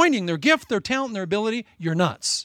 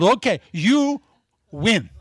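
drawn-out, louder smug okay you win; smug salesman; 3 semitones higher, 5 dB louder, slower than usual. Retold or imitated. Salesman gloating at the preacher over the bad deal.

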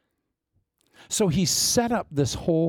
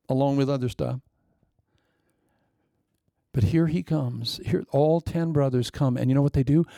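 normal talking voice

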